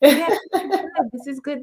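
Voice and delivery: high laughing yeah, words bubbling with laughter. Real, not acted. Agreeing with the host about celebrating tears, laughing. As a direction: laugh along in delight. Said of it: "Celebrate the tears".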